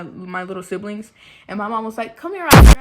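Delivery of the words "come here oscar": loud sharp call, higher; mom calling out. Retold or imitated. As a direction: call him over like a strict mom. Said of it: "that tone in her voice".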